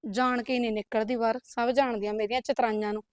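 grumbling to herself. Acted. Wife muttering about her sister-in-law, low grumble. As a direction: grumble under your breath so she can't hear.

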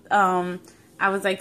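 usual speaking voice

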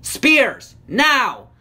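high, loud battle order; commanding shout. A narrator's reading. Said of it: "Smurfstorm commanded".

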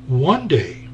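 ordinary delivery

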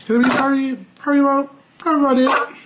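"probably" said over and over, high and teasing; playful high repeats. Real, not acted. Vlogger playfully repeats "probably" to her viewers in a high voice.

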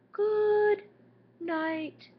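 very high, loud giraffe from way up. Acted. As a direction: sing it down from a tall neck. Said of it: "And the giraffe says".

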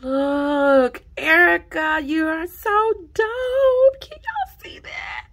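high and loud, into a squeal; ecstatic shout. Real, not acted. Vlogger cheering Erica's work, shouting with joy. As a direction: cheer her on at full voice.

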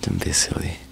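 ordinary talk, nothing marked

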